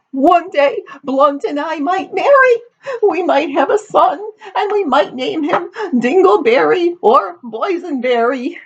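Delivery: high, hammed-up tearful wail; weeping. A narrator's reading. Daughter tells her father about the future son, weeping. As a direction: sob through it in a very high voice. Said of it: "sobbed Amity".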